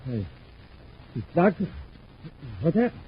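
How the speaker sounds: high, startled exclamation; shocked surprise. Acted. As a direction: jump up in pitch at the sight. Discovering injured man.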